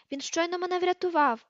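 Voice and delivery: high-pitched earnest objection; urgent protest. A narrator's reading. Harry defending Ron to Hermione, protesting. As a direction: object on his friend's behalf.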